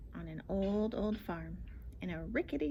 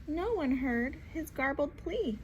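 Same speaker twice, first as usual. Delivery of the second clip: plain reading voice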